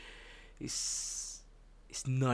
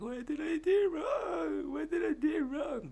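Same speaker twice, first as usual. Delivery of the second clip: pleading voice. Retold or imitated. Character crying out to his creator in a very high voice. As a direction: squeak it out like a wounded creature. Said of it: "He's like".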